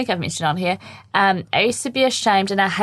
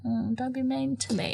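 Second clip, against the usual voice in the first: very soft, small voice, a little higher; meek retold plea; 4 semitones higher, 10 dB quieter than usual. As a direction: quietly imitate a hurt child's plea. Retold timid reply to friends.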